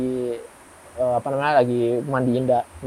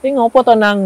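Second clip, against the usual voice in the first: higher and louder, an aunt asking what's wrong; aunt's voice; 7 semitones higher, 7 dB louder than usual. Retold or imitated. Aunt asking her nephew in the kitchen, higher, louder voice. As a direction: voice the worried aunt, pitched up.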